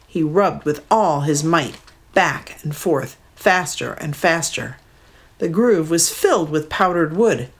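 plain reading voice

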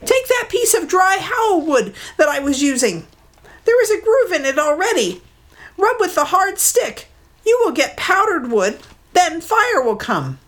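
a scared bird telling the truth; mud hen. Acted. Mud hen telling Maui the secret, high bird voice.

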